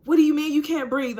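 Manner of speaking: high, loud, fast alarmed question; alarmed sister. Retold or imitated. Sister alarmed by her words, loud and high. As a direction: snap back in alarm. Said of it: "she's like".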